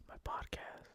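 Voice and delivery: barely voiced whisper of realization; stunned whisper. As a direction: breathe it as it dawns on you. Sudden private realization.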